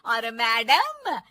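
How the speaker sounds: loud, slow, drawn-out scolding; angry mother-in-law. Acted. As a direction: order her off with heavy sarcasm. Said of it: "सास को बहू की इस बात पर गुस्सा आ जाता है".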